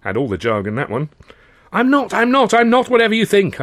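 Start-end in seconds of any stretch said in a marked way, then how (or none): 1.7-3.6 high, loud frantic denial; desperate protest. Acted. Narrator protesting to the angry mob, frantic. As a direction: plead over the noise. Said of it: "I protested".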